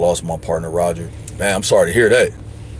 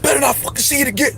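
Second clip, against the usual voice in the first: mom yelling; 12 semitones higher, 3 dB quieter, faster than usual. Retold or imitated. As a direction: shriek it like a furious mother scolding. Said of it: "I felt like my mom was getting on me".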